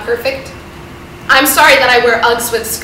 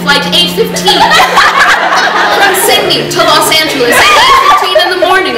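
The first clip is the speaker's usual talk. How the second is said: high, loud laughing dream talk; giddy laughing; 4 semitones higher, 4 dB louder than usual. Acted. Sam gushing about her Lost fantasy, giddy laughing. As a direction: gush through laughter about the plane.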